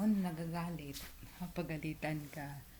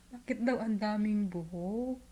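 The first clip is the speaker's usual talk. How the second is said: higher, louder puzzled boss voice; quoting the boss; 4 semitones higher, 7 dB louder than usual. Retold or imitated. Retelling the boss asking about the hair in surprise. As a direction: lightly play the boss's surprise.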